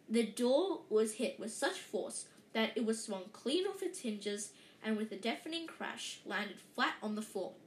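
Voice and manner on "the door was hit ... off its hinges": ordinary delivery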